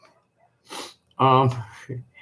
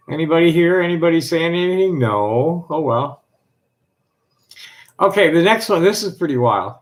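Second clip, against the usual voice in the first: usual speaking voice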